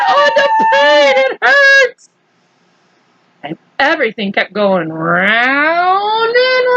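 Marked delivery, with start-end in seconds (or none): 0.1-2.1 hammed-up pain cry. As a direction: overplay the agony. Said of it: "and cries of pain".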